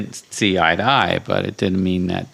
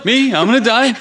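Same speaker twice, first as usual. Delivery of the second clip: shocked; 5 dB louder than usual. Retold or imitated. Tony shocked at death remark.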